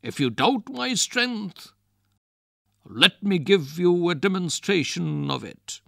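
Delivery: high, loud bragging; boastful. Acted. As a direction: show off his strength. Wrestler boasting to ghost.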